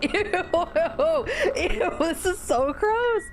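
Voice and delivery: high, giggling disgust at a gross scene; laughing in disgust. Real, not acted. Reading a vomit scene to chat, laughing in disgust. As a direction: laugh it out while squirming.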